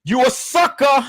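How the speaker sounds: high-pitched taunt; called-out insult. Real, not acted. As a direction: throw it out high, like a jeer. Insult called out, then repeated low.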